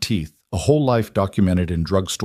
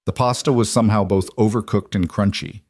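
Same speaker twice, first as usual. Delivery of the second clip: ordinary delivery, nothing marked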